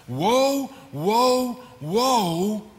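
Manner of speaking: booming herald's woe; heavenly herald's cry. Acted. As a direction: cry out like an angel of doom. Voicing the eagle from heaven.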